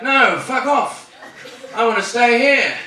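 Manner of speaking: high, protesting convict voice; one-legged convict. Acted. One-legged convict refusing the soldier, high mock protest. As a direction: play a convict stubbornly refusing. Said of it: "the one-legged convict's like".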